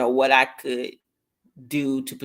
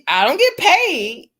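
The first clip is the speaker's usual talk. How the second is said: exasperated; 11 semitones higher, 9 dB louder, slower than usual. Real, not acted. Complaining about unpaid calls.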